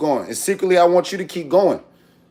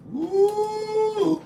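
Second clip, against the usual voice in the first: high whoop. Real, not acted. Excited whoop at food.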